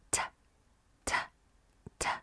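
short voiceless "T-" puff; "T-" as a light burst with no vowel; phonics T sound. Shown as a demonstration. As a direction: sound out "T-" alone, repeated. Teacher sounds out the first letter.

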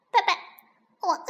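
put-on tiny high baby-duck chirp; duckling voice. A narrator's reading. Hungry duckling asks its fox daddy, high chirping plea. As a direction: plead in little short chirps. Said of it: "小鸭子说".

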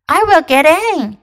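high, loud wolf voice. Acted. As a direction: insist like the wolf. Wolf insisting.

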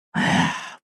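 soft, voiceless sigh; resigned sigh. A narrator's reading. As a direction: let out a quiet, rueful breath. Narrator's wry sigh at friend's posts. (轻声无奈叹气)

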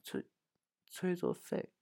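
high, very soft, halting reply; small, embarrassed high voice. Acted. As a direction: stammer it out sheepishly. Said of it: "难为情地说".

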